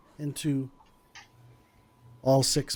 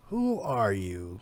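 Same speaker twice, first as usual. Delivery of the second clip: low, slow and softer, leaning in; menacing barkeep; 7 semitones lower, 6 dB quieter, slower than usual. Acted. Barkeep leans in to question the stranger, low and slow. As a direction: lean in and demand it quietly. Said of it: "he kinda leans in and he goes".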